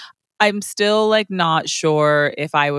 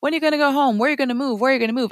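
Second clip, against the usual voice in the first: high, repetitive mimicked questions; pestering questioners; 7 semitones higher, much faster than usual. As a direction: parrot everyone's nagging questions. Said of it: "it's always this".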